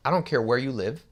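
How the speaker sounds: ordinary talk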